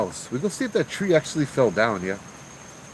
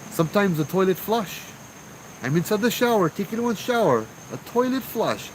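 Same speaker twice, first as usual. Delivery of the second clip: retold screaming complaint; 10 semitones higher than usual. Uncle tells Joe about the shower ghost, high-pitched complaining. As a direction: pitch it up as a mock scream of outrage. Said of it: "I scream".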